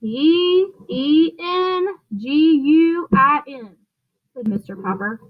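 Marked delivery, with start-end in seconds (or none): 0.0-3.8 each letter slow and separate; spelled out slowly. Acted. Mister Popper slowly spelling the word to City Hall. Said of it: "suggested that perhaps he had better spell it".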